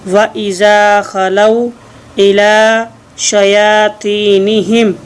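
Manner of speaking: व इज़ा खलऊ इला शयातीनिहिम read slowly as one flowing phrase; slow joined reading. Shown as a demonstration. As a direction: model the joined verse carefully for learners. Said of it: "जी आप मिला के पढ़ेंगे".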